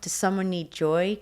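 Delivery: ordinary delivery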